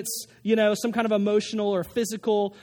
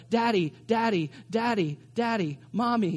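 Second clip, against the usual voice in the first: put-on insistent little kid calling for a parent; pestering child. Kid in pain tugging on a parent's shirt, pestering. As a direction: nag repeatedly like a child wanting attention. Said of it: "they start tugging on your shirt".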